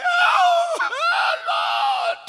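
high, drawn-out cry to the Lord; dramatic reenacted martyr's wail; loud cry. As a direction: cry out at full voice, stretching it. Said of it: "he cried out with a loud voice".

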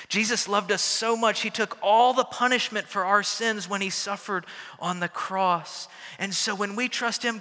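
loud, high fervent preaching. Acted. Pastor preaches the gospel to the kids and church, loud and fervent. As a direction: proclaim it with full conviction.

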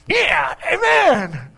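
playful shouted Yeah and Amen, like a congregation wired on coffee; mock hyped shout. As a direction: burst out cheering, playfully.

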